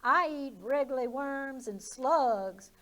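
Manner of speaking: slow, lightly voiced bird; a hint of a chirpy bird. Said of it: "replied the bird".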